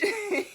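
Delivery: sharp burst of high, loud laughter. Real, not acted. Laughing as she quotes [name] to viewers. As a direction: let a squeal of a laugh out.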